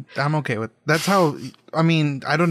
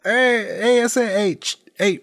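high Chicano calling out; California Mexican accent; 7 semitones higher than usual. Acted. Fairy trying to get attention, California Mexican accent. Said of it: "Just very California Mexicans".

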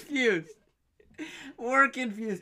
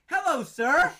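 mock-chirpy, higher "Hello, sir"; friend's awkward greeting mimicked a little higher and louder; weirdo greeting; 7 semitones higher, 5 dB louder, slower than usual. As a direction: imitate a friend's odd cheery hello. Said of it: "like a weirdo".

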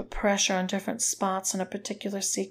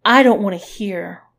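loud sneer, hit hard at the start; 10 dB louder than usual. A narrator's reading. Woman refusing to hear his confession, sneering. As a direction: cut them off with a loud sneer. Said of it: "she sneered".

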